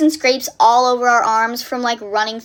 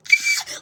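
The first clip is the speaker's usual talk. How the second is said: re-enacted gasp of fright; sharp gasp. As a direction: gasp as if about to scream. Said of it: "we start screaming. We're like".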